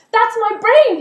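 high, very loud cry of recognition; excited shout. A narrator's reading. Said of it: "he shouted".

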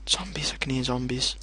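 gasp, then whispered warning; hushed alarm; fearful whisper. Real, not acted. Gamer whispering on hearing zombies.

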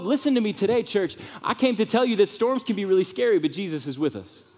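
ordinary talk, nothing marked

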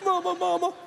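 put-on high, tiny baby cry for mom; mock baby. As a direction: whimper like a helpless baby, hammed-up. Mock baby after sucking thumb.

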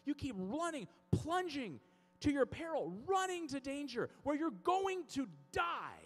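high, insistent preacher's voice; impassioned preaching. Real, not acted. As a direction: preach it hard, driving home each phrase. Preaching with urgency.

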